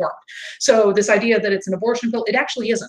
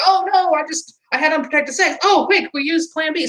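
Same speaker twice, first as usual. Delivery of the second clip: mock panic; 7 semitones higher than usual. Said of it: "It's like".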